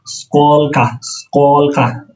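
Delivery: স্কলকা slowly as skolko; Russian how many. Shown as a demonstration. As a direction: say স্কলকা drawn out, stress on SKOL. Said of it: "কত?". (ধীরে রুশ উচ্চারণে স্কলকা)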